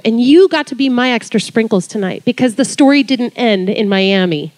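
ordinary talk, nothing marked

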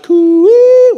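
long, high, loud sung call; hill call. Shown as a demonstration. Pastor echoing the farmer's call through the hills, long and loud. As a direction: call across the canyons for the sheep. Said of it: "you go through the hills calling out".

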